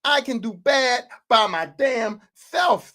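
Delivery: drawn out, word by word; slow, deliberate acted reprimand; slow emphatic repeat. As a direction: spell it out slowly as the stern boss. Same line repeated slowly for emphasis.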